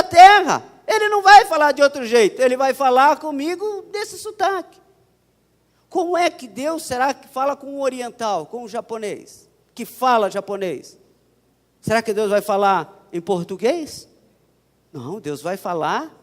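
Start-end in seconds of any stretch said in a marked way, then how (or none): none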